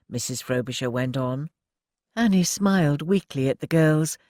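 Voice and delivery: ordinary delivery, nothing marked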